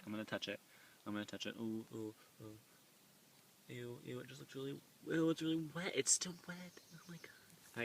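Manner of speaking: soft, disgusted, frightened hush; scared whisper. Real, not acted. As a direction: whisper in real fear. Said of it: "I'm really freaked out about this".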